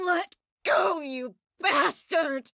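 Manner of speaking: high strained outburst, last words drawn out; struggling, angry cry. Acted. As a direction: fight to break free as you say it. Struggling against someone holding her.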